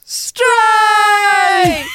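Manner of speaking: high, loud triumphant shout of the winner; excited award shout. Shown as a demonstration. Announcing the game of the year on request, excited shout. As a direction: call it out like the winner at an awards show. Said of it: "Say it again now".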